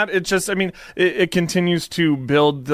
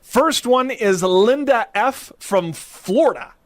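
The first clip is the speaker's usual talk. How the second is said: slow, drawn-out, raised announcement of the letter writer's name and state; slow announcing; 6 semitones higher, slower than usual. Acted. Co-host to co-host, slowly announcing the listener's state. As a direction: stretch it out like a grand reveal.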